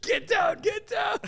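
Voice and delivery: high yelled get down, like a raid; mock shouted command. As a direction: bark the order high and loud.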